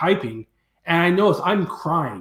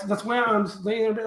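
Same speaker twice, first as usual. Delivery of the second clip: normal talking voice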